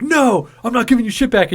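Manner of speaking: high-pitched mock indignation; retold indignant refusal. Bartender refusing to hand back the receipt, mock indignant.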